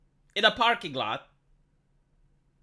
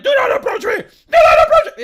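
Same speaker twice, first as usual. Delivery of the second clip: shrieked much higher and louder; mock panicked shout; 15 dB louder than usual. Commentator imagines a man screaming at shoppers, shrieking. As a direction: yell it like a man guarding Target's entrance in a panic. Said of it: "he goes like".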